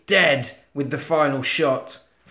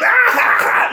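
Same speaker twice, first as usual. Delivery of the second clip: loud, high evil cackle; maniacal doll laugh; 7 dB louder than usual. Acted. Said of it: "literally laughing from the, from the side".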